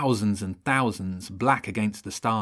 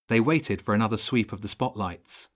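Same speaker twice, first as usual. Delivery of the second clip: usual speaking voice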